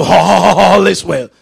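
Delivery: loud whoop, stretching ALL out in pulses; hammed-up revival preacher parody; mock whooping preacher. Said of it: "you can whoop without the voice".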